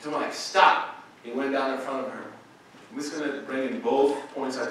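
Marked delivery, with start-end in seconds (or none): 0.3-1.0 loud, higher one-word order; commanding stop. Retold or imitated. Sheikh halting a speaker, commanding stop. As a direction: imitate a firm, sudden halt.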